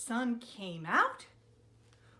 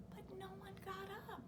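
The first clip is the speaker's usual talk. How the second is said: unvoiced whisper; 7 semitones higher, 15 dB quieter, much faster than usual. A narrator's reading. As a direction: whisper the letdown. Whispered narration.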